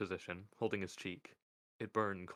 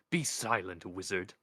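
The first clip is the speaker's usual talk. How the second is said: commanding; 5 dB louder than usual. Acted. Death commanding.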